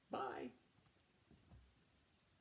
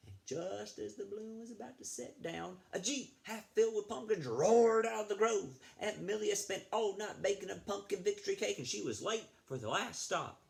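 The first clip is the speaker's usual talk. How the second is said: higher, breathless story climax; building excitement; 10 semitones higher, 3 dB louder than usual. Acted. Librarian reading the crash scene to kids, excited. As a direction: rush toward the big moment. Reading picture book to children.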